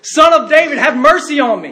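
high, loud pleading shout. Retold or imitated. Hushed beggar calling harder to Jesus, loud desperate cry. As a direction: cry out at full voice for mercy. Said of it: "he cried out all the more".

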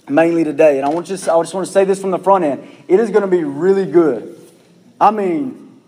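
normal talking voice

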